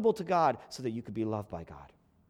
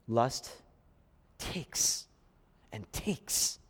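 hushed aphorism; 7 semitones lower, 5 dB quieter, much slower than usual. Real, not acted.

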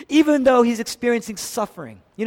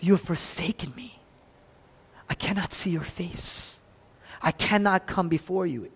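forsaken lament; 6 dB quieter than usual. Acted. Forsaken Jesus lamenting to God.

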